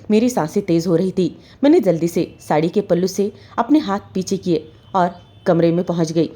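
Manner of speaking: ordinary delivery, nothing marked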